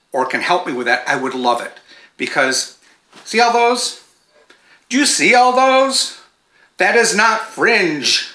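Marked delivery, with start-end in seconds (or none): 3.2-8.3 high, drawn-out mock complaint; mock exasperation. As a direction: play up the dismay at the mess. Mock outrage at loose yarn ends.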